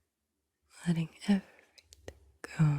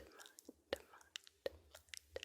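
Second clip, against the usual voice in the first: barely audible whispered repeats; fading whispered echo; 23 dB quieter, much slower than usual. Shown as a demonstration. Guide echoing the word mind, in a vanishing whisper. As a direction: let the word echo away in tiny whispers.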